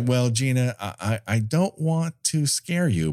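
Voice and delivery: normal talking voice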